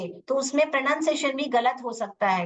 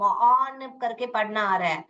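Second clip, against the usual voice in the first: ordinary delivery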